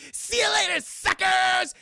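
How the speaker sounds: high, loud taunting yell; taunting shout. Retold or imitated. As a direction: jeer at them as you run off. Helena taunting while fleeing.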